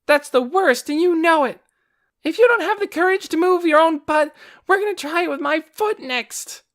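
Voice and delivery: put-on high, loud angry girl; angry scolding. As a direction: scold your brother furiously. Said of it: "turned in anger".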